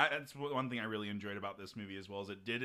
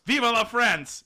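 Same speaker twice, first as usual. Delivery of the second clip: much higher, loud patriotic yell; 11 semitones higher, 13 dB louder, slower than usual. A narrator's reading. Frenchman leaping from the plane, loud yell. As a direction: shout it out as the proud Frenchman jumps.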